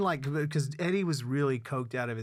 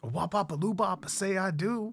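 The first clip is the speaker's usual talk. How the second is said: raised, playful rock-and-roll scat; 7 semitones higher than usual. Pretend. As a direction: joke wedding vow in a rocker's yelp. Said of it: "come see [name] marry".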